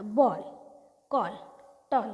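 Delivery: बॉल, कॉल, टॉल with a rounded "aw"; example-word demo. Shown as a demonstration. Teacher gives double-L examples, pronunciation demo of "aw". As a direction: list बॉल, कॉल, टॉल one by one, separated. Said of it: "अ असा करायचा".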